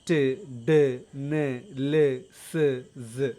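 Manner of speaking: ട്, ഡ്, ന്, ല്, സ് each said alone with tongue tip; alveolar sounds. Shown as a demonstration. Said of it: "alveolar sound". (ട്, ഡ്, ന്, ല്, സ് നാവിൻ്റെ അറ്റം തട്ടിച്ച് ഓരോന്നായി)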